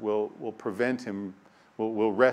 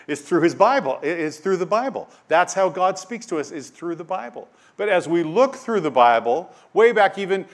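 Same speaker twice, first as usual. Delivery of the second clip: louder, higher preaching climax; preaching at full force; 7 semitones higher, 8 dB louder than usual. Acted. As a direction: drive the point home to the congregation.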